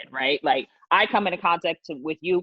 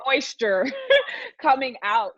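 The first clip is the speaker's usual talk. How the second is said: laughing through the words, pitched up; amused; 7 semitones higher than usual. Real, not acted. Explaining masks to friends, amused and laughing. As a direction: let a laugh break into the phrase.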